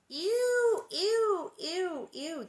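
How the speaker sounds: high, loud squeals of disgust fading out. Real, not acted. Reader squealing at the bugs, disgusted. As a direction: squeal ew over and over, grossed out. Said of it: "that was so gross".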